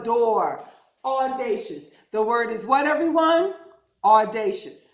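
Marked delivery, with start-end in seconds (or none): none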